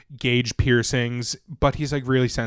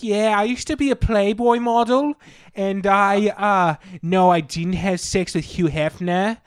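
ditzy model voice; 6 semitones higher, slower than usual. Retold or imitated. Playboy model quoted, high voice.

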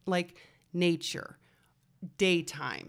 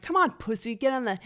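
goading peers; 4 semitones higher, 5 dB louder than usual. Acted. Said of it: "You know, they're like".

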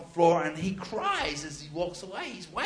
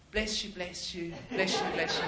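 soft, hesitant mock consoling; quiet, uneasy reassurance, retold; awkward comforting; 2 semitones lower, 6 dB quieter than usual. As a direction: murmur it like an awkward pat on the back. Awkwardly patting a wailing man.

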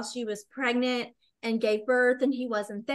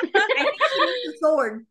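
laughing; 10 semitones higher, 6 dB louder than usual. Real, not acted. Repeats joke laughing.

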